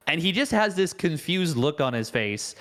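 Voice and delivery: ordinary talk, nothing marked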